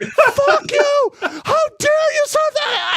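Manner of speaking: very high, loud outraged screaming; retold going off. First sergeant bursting into the company office, screaming furiously. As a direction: re-enact tearing into him at the top of your range. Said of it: "I was fucking going off".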